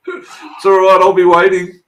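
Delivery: words squeezed out through high laughter; laughing. Real, not acted. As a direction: laugh while you answer. Laughing reply to joke.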